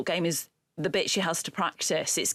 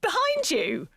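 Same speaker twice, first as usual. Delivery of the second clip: high, astonished question; incredulous; 10 semitones higher, slower than usual. Real, not acted. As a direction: blurt it out in high-pitched disbelief. Astonished reaction to a backwards shot.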